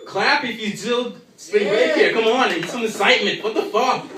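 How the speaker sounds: loud, high, begging the audience; hyped comic pleading for applause; pleading with crowd. Real, not acted. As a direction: whip the crowd up, half laughing. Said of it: "begging crowd for excitement".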